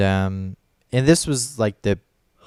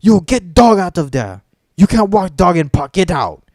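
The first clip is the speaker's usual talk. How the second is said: mocking a rude worker's broken English, loud and higher; higher, louder retold order in broken English; rude park worker; 7 semitones higher, 6 dB louder than usual. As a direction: bark it like a curt guard shooing you off. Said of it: "comes up to me and says".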